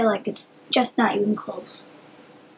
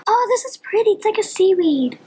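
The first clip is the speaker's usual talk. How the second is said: delighted; 9 semitones higher than usual. Real, not acted. Delighted by the paint colour.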